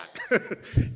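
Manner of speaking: real chuckle at his own joke; short laugh. Real, not acted.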